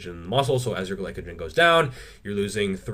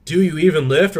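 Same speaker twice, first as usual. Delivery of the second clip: loud, high mocking challenge; mock heckler; 8 semitones higher, 8 dB louder than usual. Lifter imitating people who doubt his physique, loud mock heckling. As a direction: jeer it like a gym heckler. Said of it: "People are like".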